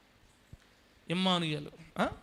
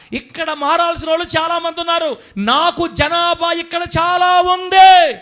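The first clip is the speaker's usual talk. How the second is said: high, loud, shouted proclamation; preached divine command; 9 semitones higher, 10 dB louder than usual. Acted. Preacher shouting Jesus's words to Paul in the vision. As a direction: thunder it out as God's command.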